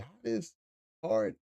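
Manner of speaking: high, incredulous mocking questions; a hint of puzzled rivals; mock confused high voices; bewildered onlookers. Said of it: "They be like".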